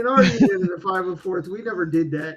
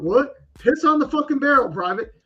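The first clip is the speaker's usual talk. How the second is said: higher and louder, rising to a startled yelp; shocked disbelief; 8 semitones higher, 7 dB louder than usual. Retold or imitated. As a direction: play the stunned private, voice climbing. Private's shocked reaction.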